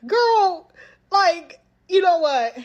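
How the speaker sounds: high, loud fed-up outburst; mock blow-up at a cousin; acted exasperation. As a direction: throw it out high, totally over it.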